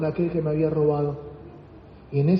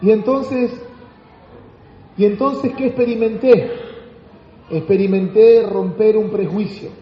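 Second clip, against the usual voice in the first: ordinary talk, nothing marked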